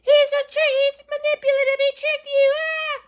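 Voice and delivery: mock screaming mom. Mom screaming at the doctor, mocked high shriek. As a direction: imitate a mom shrieking accusations, hammed up. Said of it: "my mom was screaming".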